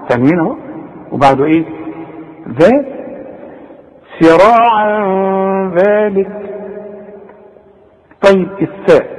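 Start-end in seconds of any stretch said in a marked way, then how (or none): 4.2-6.3 slow chanted recitation with nasal tanween; tajweed recitation. Shown as a demonstration. Teacher demonstrating tanween ikhfa, slow melodic recitation. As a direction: recite سراعا ذلك slowly, hiding the tanween into the ذال with ghunna. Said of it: "واخد بالك".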